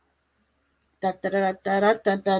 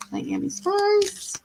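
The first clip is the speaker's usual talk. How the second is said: high, slow, sing-song call of Miami Spice; sing-song name call; 9 semitones higher, 5 dB quieter, much slower than usual. Real, not acted. Tarot reader calls out to a viewer in chat, high slow sing-song. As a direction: croon the name slowly up high like calling a pet.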